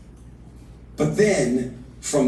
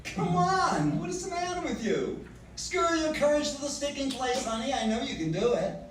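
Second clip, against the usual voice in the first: much higher, mocking disbelief; scoffing laugh-through; 6 dB quieter than usual. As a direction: laugh off his doubt and egg him on. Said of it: "Ellen Terry did it with a laugh".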